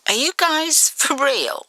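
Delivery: put-on doubtful boy questioning strangers; disbelieving smirk. A narrator's reading. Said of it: "he frowned with a disbelieving smirk".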